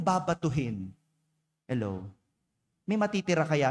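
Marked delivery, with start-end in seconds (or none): 1.7-2.0 sarcastic hello. Real, not acted. Preacher mocks the silent congregation.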